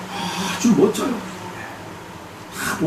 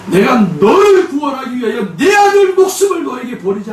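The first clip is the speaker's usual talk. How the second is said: high, loud proclaiming shout; commanding proclamation; 8 semitones higher, 4 dB louder than usual. Retold or imitated. God rebuking the mother through her prayer, proclaiming loudly. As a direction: thunder it like a voice from heaven.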